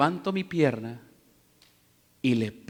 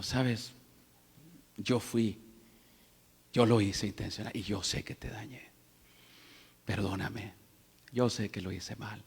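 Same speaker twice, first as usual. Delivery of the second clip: quiet apology; 6 semitones lower, 8 dB quieter than usual. Retold or imitated. Pastor apologising to the player.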